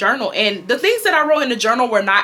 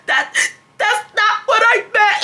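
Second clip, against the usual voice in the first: high, stammering mock whimper; hammed-up pleading teen; whimpering protest; 12 semitones higher than usual. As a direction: choke out the excuse pitifully. Retold tearful protest to angry mother.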